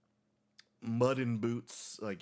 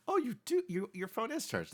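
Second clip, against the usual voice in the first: surprised; 3 dB louder than usual. Acted. Mock surprised phone voice.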